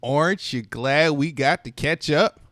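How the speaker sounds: ordinary delivery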